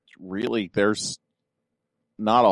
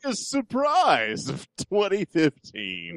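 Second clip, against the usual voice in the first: high, slow, stretched-out announcement; put-on announcer voice; 10 semitones higher, 3 dB quieter than usual. Host announcing the first category, grand announcer voice. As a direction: draw out each word like a hammed-up awards host. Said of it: "do it in that voice".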